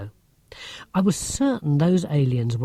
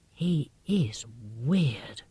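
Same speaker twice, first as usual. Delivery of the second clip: slow, breathless hushed whisper; 3 semitones lower, 7 dB quieter, much slower than usual. A narrator's reading. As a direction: whisper it so he can't hear. Girl whispering about alien neighbour.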